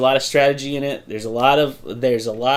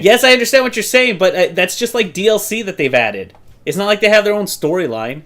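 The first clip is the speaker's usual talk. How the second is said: heated, raised-pitch pushback; getting mad; 4 semitones higher than usual. Real, not acted. Podcast co-host argues over fan service, getting mad. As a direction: argue back, worked up. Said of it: "Why are you getting so mad".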